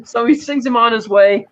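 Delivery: usual speaking voice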